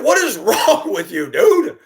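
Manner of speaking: high-pitched, laughing exasperation; laughing disbelief. Retold or imitated. Bus driver to horsey kid, laughing and incredulous. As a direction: scold through laughter, pitch jumping up. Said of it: "I started laughing".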